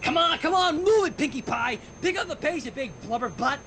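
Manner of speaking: high, pushy trainer yell; bully her up the track; shouting coach. Acted. Dash shouting at jogging Pinkie, harsh trainer yell. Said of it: "Dash's whistle... shouting through a bullhorn".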